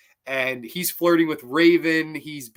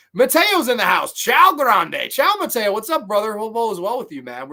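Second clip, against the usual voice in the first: loud, high, happy hello to a viewer; excited greeting; 8 semitones higher, 7 dB louder than usual. Real, not acted. Streamer loudly greeting Mateo as he joins the chat, excited. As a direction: call out a big welcome.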